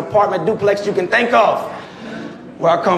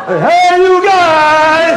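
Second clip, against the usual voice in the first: loud, high bellow of Sloth; big hammed-up Goonies yell; Sloth from Goonies shout; 9 semitones higher, 6 dB louder, much slower than usual. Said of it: "Slop Lil Chump".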